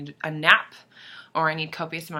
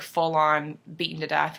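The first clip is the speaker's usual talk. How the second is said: ordinary talk, nothing marked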